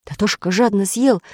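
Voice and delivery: usual speaking voice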